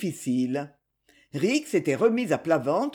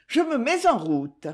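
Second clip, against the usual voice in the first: higher-pitched, lightly voiced call; 7 semitones higher than usual. A narrator's reading. Lucette announces she is setting off, calling out. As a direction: call out with a mild lift in pitch. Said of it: "cria-t-elle".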